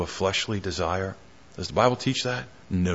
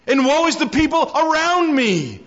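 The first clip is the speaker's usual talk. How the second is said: Isaiah crying out. Retold or imitated. Preacher voicing Isaiah before God, lamenting cry. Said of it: "Isaiah cries out".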